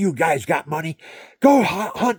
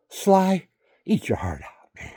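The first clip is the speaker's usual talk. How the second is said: ordinary delivery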